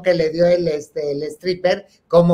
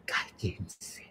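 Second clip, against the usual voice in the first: hushed unvoiced hiss to the room; whisper; 9 semitones lower, 17 dB quieter than usual. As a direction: whisper it to shush them. Hushing the others.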